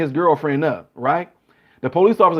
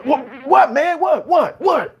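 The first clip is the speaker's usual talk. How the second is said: pitched high and confrontational; as if the greeting were an attack; defensive hostile kid; 9 semitones higher, slower than usual. Retold or imitated. Kid to a friendly officer, high and confrontational.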